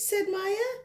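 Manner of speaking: plain reading voice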